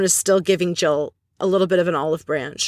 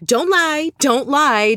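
mock retort, pitched high on each "don't lie"; clapping back; 7 semitones higher, 4 dB louder, much slower than usual. Ramona turns Jill's words back on her, mock clapback. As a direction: imitate a sharp comeback, voice jumping up. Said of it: "Ramona turns around and claps back".

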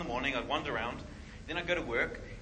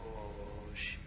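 awed whisper; 5 semitones lower, 10 dB quieter than usual. Retold or imitated. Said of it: "And I was like".

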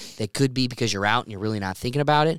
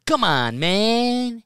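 rising, louder impersonation of Joe Biden; Joe Biden impression; 10 semitones higher, 5 dB louder, much slower than usual. Host imitates his soundboard clip of Joe Biden. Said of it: "Joe Biden going".

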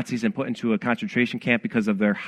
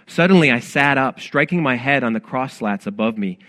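ordinary talk

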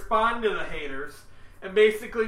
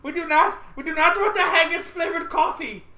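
put-on German accent; 8 semitones higher, 5 dB louder than usual. Mock German voice.

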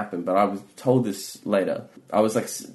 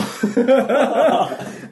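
loud high laugh; 4 dB louder than usual. Real, not acted. Laughs at her own story.

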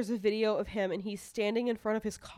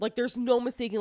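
ordinary talk, nothing marked

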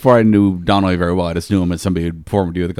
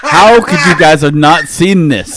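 laughing shout; 8 semitones higher, 6 dB louder, slower than usual. Real, not acted. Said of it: "We should have seen that coming".

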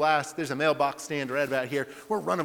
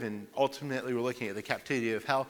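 ordinary talk, nothing marked

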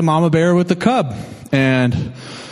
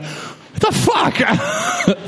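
high, drawn-out cry of disbelief; mock shocked yell; much slower than usual. Hiker meeting the bear again, mock shocked yell. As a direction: stretch it out high, acting shocked.

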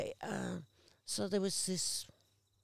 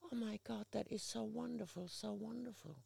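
softer, choked-up gratitude; a touch of tears in the voice, softer; tearful; 3 semitones higher, 9 dB quieter than usual. Retold or imitated. Patient moved to tears by free beanie, softly tearful. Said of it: "she burst into tears".

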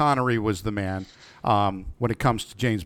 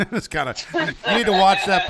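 usual speaking voice

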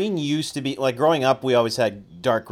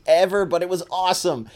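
ordinary talk

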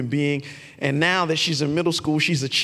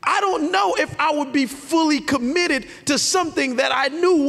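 high, ringing sermon delivery with weighty pauses; emphatic preaching; 9 semitones higher than usual. Acted. Preacher declares Jesus committed to the end, emphatic preaching. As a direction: preach it high, drawing out key words.

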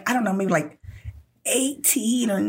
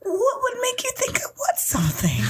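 much higher, half-laughing innocent question; unnatural high voice; 13 semitones higher than usual. Retold or imitated. Daughter playing innocent to her dad, squeaky and high. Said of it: "my voice is all, like, unnatural".

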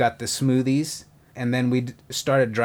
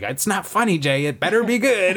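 put-on East Coast accent; 6 semitones higher than usual. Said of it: "I love his East Coast accent".

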